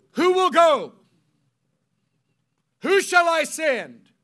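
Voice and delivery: high, loud preaching voice; booming call. Acted. Preacher voicing God calling to Isaiah, loud and high. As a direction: boom out God's question.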